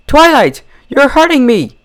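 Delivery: high, loud cry of pain. Acted. Rarity crying out to Twilight in pain. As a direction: cry out as the hoof is crushed. Hoof crushed in door.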